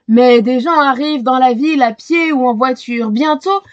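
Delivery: ordinary delivery, nothing marked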